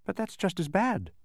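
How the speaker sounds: high, unforced protest. A narrator's reading. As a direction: object mildly. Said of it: "protested Milo".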